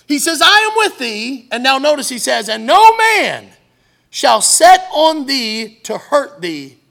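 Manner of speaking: loud, slow scripture proclamation; emphatic preaching. Real, not acted. Preacher reads Jesus's promise to the congregation, emphatic preaching. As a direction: proclaim the verse at full force, drawing out key words.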